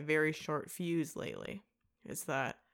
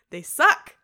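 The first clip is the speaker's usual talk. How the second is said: loud repeat; 6 semitones higher, 15 dB louder than usual. Real, not acted. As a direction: shout "They suck" much louder, with a rising punch.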